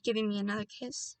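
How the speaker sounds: usual speaking voice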